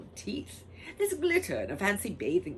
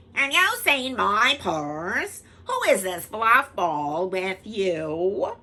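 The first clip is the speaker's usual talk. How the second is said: Missus Gobblecracker; 5 semitones higher, 12 dB louder, slower than usual. Acted.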